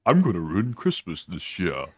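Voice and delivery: slow, sly villain drawl of a Grinch; a lightly voiced Grinch, slow and scheming. Comparing Ukraine's attack to the Grinch, slow villain voice. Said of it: "came in like the Grinch and said".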